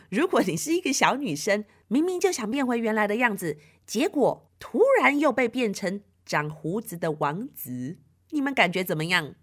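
ordinary delivery, nothing marked

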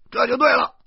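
loud, high, triumphant approval; unrelenting shout. Acted. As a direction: holler it in vindication. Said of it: "我不依不饶地喊着".

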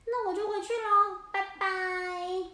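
high, loud cheery penguin goodbye; squawky bouncy penguin. Acted. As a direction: sing out a bright goodbye. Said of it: "他就跟汪汪老板说".

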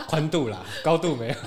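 high, loud words through laughter; laughing tease. Real, not acted. Guest teases host about width not height, laughing. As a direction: jab playfully while laughing. Teasing host's size.

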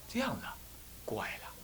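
usual speaking voice

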